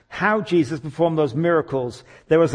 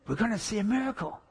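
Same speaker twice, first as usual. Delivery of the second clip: high, hushed thrill; imitating an excited crowd; excited anticipation; 6 semitones higher, 6 dB quieter than usual. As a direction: say it softly at a raised pitch, bursting with expectation. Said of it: "All excited".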